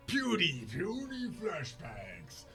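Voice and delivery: loud, high, drawn-out taunt; hammed-up villain taunt; taunting enemy. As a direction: sneer theatrically at the puny attacker. Game master voices the struck enemy.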